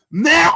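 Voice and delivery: loud high goat bleat; shouted high goat cry; goat scream. Retold or imitated. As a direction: blast out a bleating goat yell. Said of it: "if it screamed".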